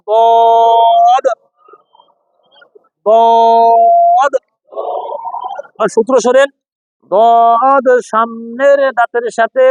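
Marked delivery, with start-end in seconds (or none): 0.1-4.3 দত drawn out very slowly; Arabic letter demo. Shown as a demonstration. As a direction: stretch দত for students to repeat. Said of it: "বলেন".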